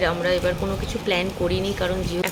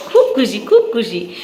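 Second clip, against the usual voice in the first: happy laugh; 3 semitones higher, 5 dB louder than usual. Real, not acted. Laughing delight with toddler.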